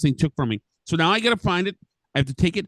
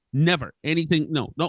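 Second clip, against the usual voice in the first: ordinary talk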